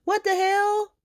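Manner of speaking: exasperated squeal. Real, not acted. Host outraged at Gio and Emma ignoring the dog, high squeal.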